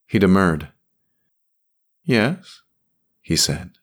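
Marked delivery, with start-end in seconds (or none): none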